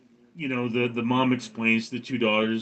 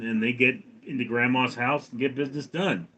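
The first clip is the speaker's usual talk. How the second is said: usual speaking voice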